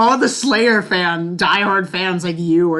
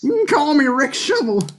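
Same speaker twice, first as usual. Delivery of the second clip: hillbilly voice; 9 semitones higher than usual. Pretend. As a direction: push the hillbilly voice higher. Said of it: "crazy sounding".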